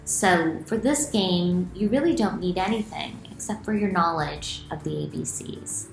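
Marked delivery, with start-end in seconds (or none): none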